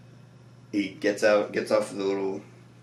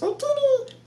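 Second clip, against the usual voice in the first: much higher, squeaky loud alien gibberish; Jawa speak; 4 dB louder than usual. Retold or imitated. Mocking what the Jawa says, squeaky gibberish yelp. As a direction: yelp it like a tiny desert scavenger. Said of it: "all he says is".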